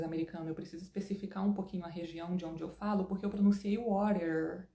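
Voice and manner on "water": WATER with the final R pulled long; strong final R. Shown as a demonstration. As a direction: draw out the R at the end of WATER. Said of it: "puxando bastante o R final".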